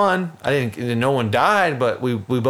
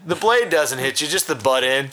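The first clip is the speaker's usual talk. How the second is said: usual speaking voice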